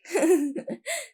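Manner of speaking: an amused little laugh; girlish laugh. A narrator's reading. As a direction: laugh lightly at his answer. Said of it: "वो लड़की हंसने लग जाती है".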